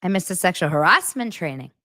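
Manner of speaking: "harassment" spikes upward, then falls back; imitated odd cadence. Proving nobody would say it like that, voice jumping on "harassment". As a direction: mimic him with a jump up on "harassment". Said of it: "You would never say it like that".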